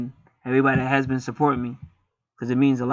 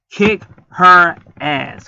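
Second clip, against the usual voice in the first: very slow, louder, every word stretched; drawn-out mock threat; 4 semitones higher, 7 dB louder, much slower than usual. Vlogger egging a viewer to fight the hater, slow stretched mock threat.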